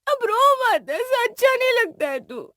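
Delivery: very high, crying voice; tearful consoling. Acted. Departing sister tells brother not to cry, high and tearful. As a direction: hush him while weeping loudly yourself.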